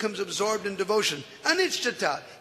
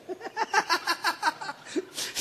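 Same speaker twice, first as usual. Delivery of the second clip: high airy giggle; 12 semitones higher, 5 dB quieter than usual. Real, not acted. Laughing over the story.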